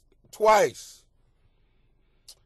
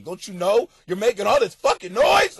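angry parent; 5 semitones higher, 7 dB louder than usual. Retold or imitated. Parent inwardly yelling at baby.

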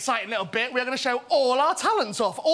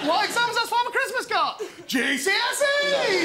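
excited punchline; 9 semitones higher than usual. Acted. Retelling the joke as a punchline.

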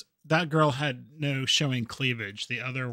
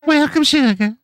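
mock southern accent; 12 semitones higher, 9 dB louder than usual. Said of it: "have that accent".

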